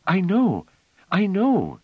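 put-on little girl eagerly interrupting; eager child. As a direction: rush it like a child who can't wait. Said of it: "said Phelim eagerly".

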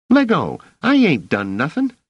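put-on high, loud boy's voice; scrappy kid struggling to get free; protesting street kid. A narrator's reading. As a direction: squeal in indignant protest. Boy protests being seized by officer.

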